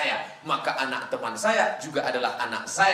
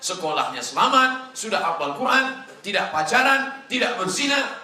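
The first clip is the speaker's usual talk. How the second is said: rising pride; 7 semitones higher, 5 dB louder than usual. Acted. Proud mother lists achievements.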